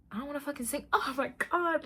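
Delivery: high, loud and fed up; exasperated outburst. Real, not acted. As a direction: blurt it out laughing. Outburst at trainer's demand.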